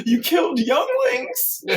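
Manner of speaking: laughing mock horror; shocked squeal. Padme's shocked line.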